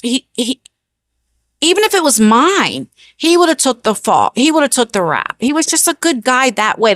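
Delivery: ordinary talk, nothing marked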